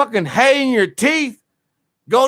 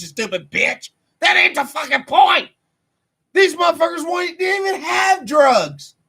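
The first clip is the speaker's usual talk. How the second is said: angry shouting; 7 semitones higher than usual. Real, not acted. Yelling back at the documentary.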